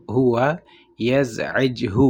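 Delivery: each word apart with a pause, very slow. Shown as a demonstration. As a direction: break it into separate words. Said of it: "اور اگر آپ کہنا چاہتے ہیں". (الگ الگ لفظ، بہت آہستہ)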